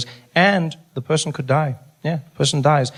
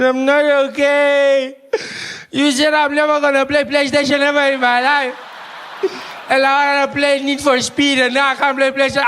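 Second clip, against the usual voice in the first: crying little brother; 12 semitones higher than usual. Retold or imitated. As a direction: wail like a hurt little kid. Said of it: "He says".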